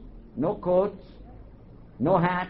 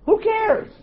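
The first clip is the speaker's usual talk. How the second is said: high, loud brush-off, as if the question is absurd; much higher and louder, scoffing; dismissive exclamation; 9 dB louder than usual. Acted. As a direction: toss it away loudly: who cares. Dismissing an imagined audience objection.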